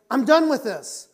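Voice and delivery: high, loud burst of resolve; emphatic shout. Acted. Preacher declaring an end to marital fighting, emphatic shout. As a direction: shout it like drawing a line.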